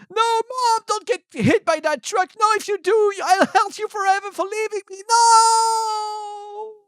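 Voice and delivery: very high, loud desperate wail; high-pitched shrieked plea; screaming child. Acted. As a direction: scream like a child begging mother, stretching the last word. Child screams as mother faces truck.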